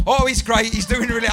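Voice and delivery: loud, forced cheering from the stands; hammed-up crowd cheer for the player; overeager cheer. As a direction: overdo the praise to make amends. Cheering the nephew after the gaffe.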